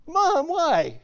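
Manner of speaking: put-on high, whiny kid asking why; whiny child. Kid pleading with mom, high and whiny. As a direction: pipe up like a little kid.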